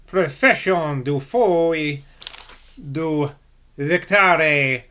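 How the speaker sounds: slow French attempt sliding into Italian accent; botched French accent. Reader attempting a French title, botched Italian-sounding French accent. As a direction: try French, let it come out Italian. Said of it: "when I try and do a French accent, I do an Italian accent".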